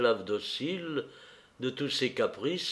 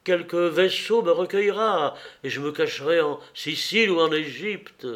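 frantic hope; 6 semitones higher, 4 dB louder than usual. A narrator's reading. Nero frantically plans escape.

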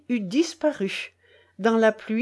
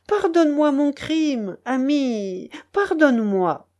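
pleading; 5 semitones higher, 4 dB louder than usual. A narrator's reading. Young peasant begs forgiveness for his crime, pleading. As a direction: beg a friend for forgiveness.

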